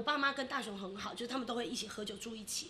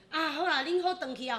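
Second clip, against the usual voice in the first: louder, brisk mom hurrying them out; mom shooing them off; 4 semitones higher, 5 dB louder than usual. Retold or imitated. Mom telling son's family to go home, brisk and louder. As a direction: hustle the guests out the door.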